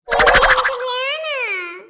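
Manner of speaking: put-on high, loud, very slow child refusal; sulky child voice. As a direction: drag it out like a pouting little girl.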